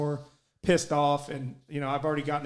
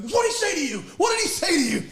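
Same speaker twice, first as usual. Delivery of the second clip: high, loud imitated demand; riled-up coach yell; 14 semitones higher, 6 dB louder, faster than usual. Player imitating Vittorio confronting him from the dugout, riled-up shout. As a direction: shout it like a coach puffed up for a fight. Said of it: "like a rooster ready to fucking".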